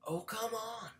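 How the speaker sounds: high, loud mock heckle; imitating a bystander's loud jeer; imitated yell. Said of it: "one of them yelled".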